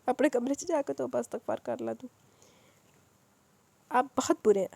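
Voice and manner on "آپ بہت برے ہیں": a hint of angry, breathless reproach. Haya scolds Waris, mildly angry. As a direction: snap at him, a touch louder. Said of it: "غصے سے بولی".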